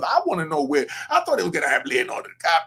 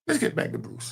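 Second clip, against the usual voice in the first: breathy, voiceless aside back to topic; a hushed, unvoiced host's transition; whispered segue; 8 semitones higher, 4 dB quieter than usual. Real, not acted. Steering the show back on topic.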